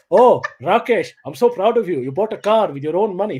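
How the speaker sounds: swooping, doting father voice; imitated proud dad. Dad praising the imagined doctor son, mock proud. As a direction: gush over a favourite son in dad's voice. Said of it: "my dad's going in there talking to".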